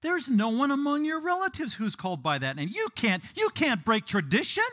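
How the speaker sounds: high, indignant mock protest; protesting relatives. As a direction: object loudly in a high voice. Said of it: "and they said to her".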